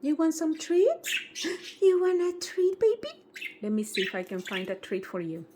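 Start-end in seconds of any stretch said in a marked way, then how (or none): 0.0-3.2 very high sing-song coaxing voice; coaxing pet talk. Real, not acted. Owner coaxing Charlotte into her cage, high sing-song. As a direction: sweet-talk the bird back into its house.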